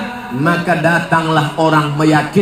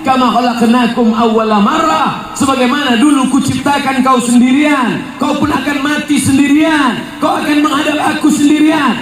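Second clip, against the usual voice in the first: fiery preaching; 7 semitones higher than usual. Acted. Preacher warns the congregation, fiery preaching. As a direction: thunder the verse at the crowd.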